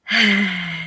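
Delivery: audible breathy sigh; real sigh. Real, not acted. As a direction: let out a short, heavy sigh. Pause before admitting a challenge.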